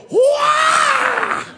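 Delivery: very high shouted whoop; excited cry. Retold or imitated. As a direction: whoop as if hooking a huge marlin. Fish story, big catch cry.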